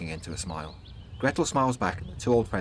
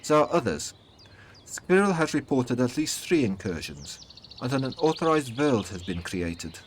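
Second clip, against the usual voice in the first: put-on soft German accent, pitched high; higher voice with a light German accent; Gretel; 5 semitones higher than usual. A narrator's reading. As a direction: greet an old friend in a gentle German accent. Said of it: "in her soft German accent".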